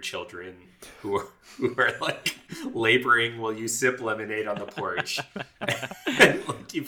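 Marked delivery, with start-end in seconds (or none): 5.2-6.1 laughing. Real, not acted. Laughing at own joke.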